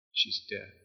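hushed whisper; preacher echoing the news in a whisper. Acted. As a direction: let it sink in, whispering.

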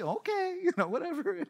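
high, soft, laughing mimicry of his wife; dismissive wife. Wife answers husband's kosher plan, mock-dismissive high voice. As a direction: humour him in a high voice. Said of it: "And she".

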